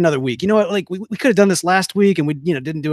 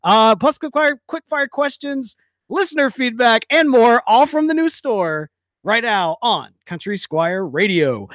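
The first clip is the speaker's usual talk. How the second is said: radio announcer; 6 semitones higher, 5 dB louder than usual. Acted. Radio show opening announcement.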